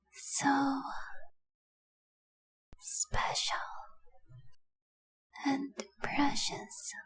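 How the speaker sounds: slow, breath-filled reverie, long pauses between words; entranced adoration. Acted. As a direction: drift off softly while gazing at your treasure. Entranced staring at her treasure.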